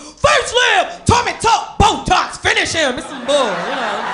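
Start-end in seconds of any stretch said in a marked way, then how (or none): 0.0-3.0 loud, high fighting-game calls; Mortal Kombat announcer. Acted. Gamer hears surgeries as fight moves, shouted game calls. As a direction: shout each one like a combat move. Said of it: "sound like I'm playing Mortal Kombat".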